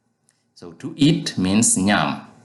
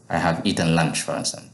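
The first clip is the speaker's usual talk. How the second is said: ordinary talk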